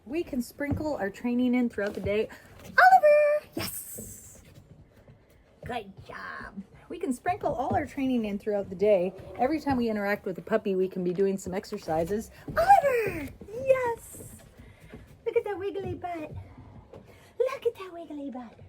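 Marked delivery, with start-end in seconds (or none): 2.7-6.6 high sing-song praise; puppy praise voice. Real, not acted. Calling then praising puppy. 12.5-18.6 puppy praise voice. Real, not acted.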